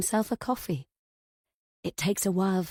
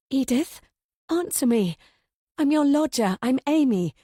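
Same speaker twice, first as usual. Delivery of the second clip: louder, higher urgent demand; alarmed insistence; 5 semitones higher, 4 dB louder than usual. A narrator's reading. Narrator as Amy urges confused landlady, alarmed and loud. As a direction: make her answer you, alarmed.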